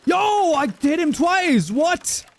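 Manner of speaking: high, loud and slow with hype; excited gamer shout. Real, not acted. Streamer hyped over a double hit, loud excited yelling. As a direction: yell it like you just landed a huge snipe.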